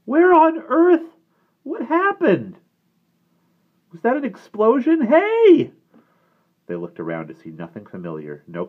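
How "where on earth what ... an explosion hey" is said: very high, loud, bewildered squeal; cracked adolescent voice. A narrator's reading. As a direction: cry out in a cracking boy's voice. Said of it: "Denny's equally cracked voice followed".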